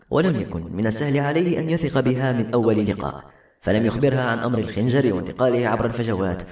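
ordinary delivery, nothing marked

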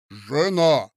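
loud, weighty address; pompous. A narrator's reading. Husband pompously addressing his wife, loud. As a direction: announce it with self-importance. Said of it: "с важностью начал".

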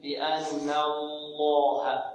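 بأن الله very slow with careful nun; correct reading. Shown as a demonstration. Teacher repeating correct form for students. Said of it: "إذن هكذا".

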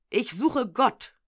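slow, pleading second cry; repeated cry. A narrator's reading. Madman repeating his cry to the crowd, slow. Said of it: "unaufhörlich schrie".

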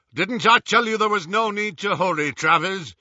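loud, high rough-voiced thug. A narrator's reading. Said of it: "said a rough voice".

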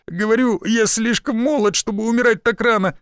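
straining groan. Acted. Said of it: "прокряхтел я".

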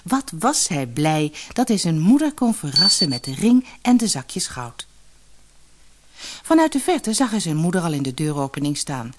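plain reading voice

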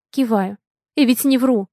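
plain reading voice

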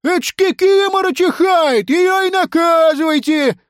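very high, drawn-out protest; high-pitched pupil's outraged wail; indignant cry. Acted. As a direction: yell that it is unfair. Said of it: "закричал Емеля".

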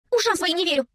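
high, dismayed exclamation; upset. A narrator's reading. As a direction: cry out in disbelief and disappointment. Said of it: "расстроилась Билли".

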